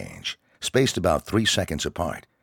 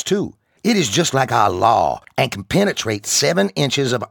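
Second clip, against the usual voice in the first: higher, louder barking sergeant voice; drill sergeant; 8 semitones higher, 6 dB louder than usual. Acted. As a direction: bark it at the recruits like a drill instructor. Sergeant lectures recruits.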